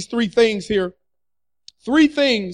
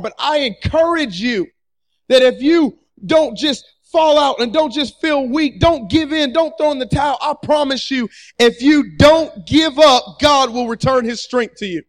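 preach; 3 semitones higher, 3 dB louder than usual. Real, not acted. Preacher exhorting congregation.

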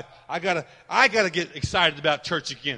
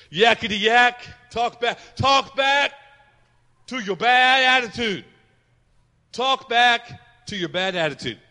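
1 excited; 5 semitones higher, 6 dB louder, slower than usual. Real, not acted. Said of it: "write this down. We gotta learn to talk back".

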